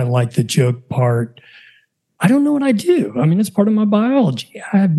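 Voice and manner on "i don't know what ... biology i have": much higher-pitched, helpless plea; mock helpless protest. Real, not acted. Podcaster defending his dad-joke habit to listeners, mock helpless. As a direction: plead innocence in a jumped-up high pitch.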